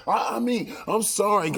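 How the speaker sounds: high-pitched, stammered backpedal; hammed-up meek apology; mock apology. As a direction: imitate someone backing down, voice way up. Acted backing-down apology.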